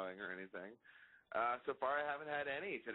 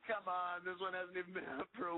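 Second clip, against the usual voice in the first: "Come on" whined much higher in mock complaint; mock whiny protest; 7 semitones higher than usual. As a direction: play-act a wounded whine on "Come on". Protests the album question.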